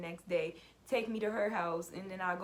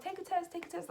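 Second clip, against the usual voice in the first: mock high nagging friends, much higher; 9 semitones higher than usual. Friends pushing her to take a pregnancy test, high mock nagging. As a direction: squeak it like pushy friends.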